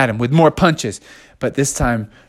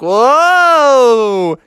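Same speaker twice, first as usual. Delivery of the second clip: long 'whoa' swooping up and down; high, swooping mock yell; cartoon flung-through-the-air whoa; 7 semitones higher, 4 dB louder than usual. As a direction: holler it as if thrown through the air. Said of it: "he was like".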